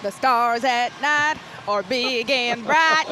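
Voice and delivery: put-on slow, drawn-out Texas accent. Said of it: "I had to put my Texas accent on".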